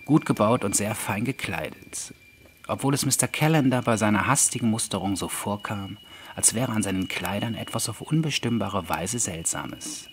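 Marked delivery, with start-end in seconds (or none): none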